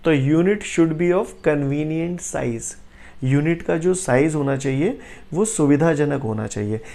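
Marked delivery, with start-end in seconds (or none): 0.1-2.7 very slow, word by word; slow dictation. Shown as a demonstration. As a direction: dictate it slowly for notes. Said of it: "जब भी हम unit लिखें".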